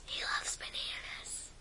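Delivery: whisper. Pretend. Whispered line.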